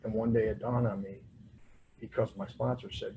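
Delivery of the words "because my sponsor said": usual speaking voice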